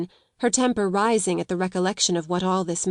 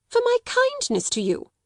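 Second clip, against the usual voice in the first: indignant demand. A narrator's reading. Headmistress demands gratitude.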